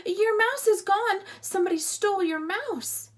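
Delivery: high, loud urgent call. A narrator's reading. Lena calling the news to Garf, loudly. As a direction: shout the bad news. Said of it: "called Lena".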